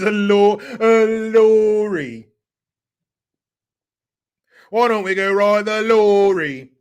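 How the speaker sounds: loud, high, drawn-out British caricature; hammed-up British, stretching the vowels; mock British accent. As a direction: bellow "lorry" in fake British. Trying British word for truck.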